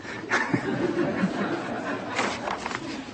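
airy silent laugh; breathy unvoiced laugh. Real, not acted. Host laughs at recitation.

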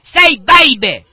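screamed high and slow into the ear; hammed-up healer yelling at a deaf kid; mock preacher scream. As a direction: bellow it like a faith healer at full force. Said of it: "He'll scream into their ear".

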